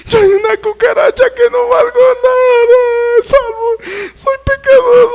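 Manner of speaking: high-pitched, loud, hammed-up sobbing prayer; mocking a crying penitent at the altar; mock wailing parishioner. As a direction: wail and blubber like a repentant churchgoer overdoing it. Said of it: "empiezan a llorar y llorar y llorar".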